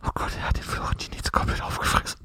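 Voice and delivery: hushed hiding whisper. Acted. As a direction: whisper so nobody finds you. Hiding behind a stone.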